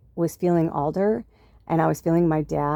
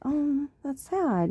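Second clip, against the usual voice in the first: high, soft, slow sigh of pity; sad; 9 semitones higher, 5 dB quieter, much slower than usual. Retold or imitated. To herself about the missed birthday, soft slow pitying sigh. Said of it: "I was feeling a little sad just because I was like".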